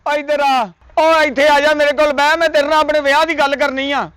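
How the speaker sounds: calling out. Acted. Calling the matchmaker over.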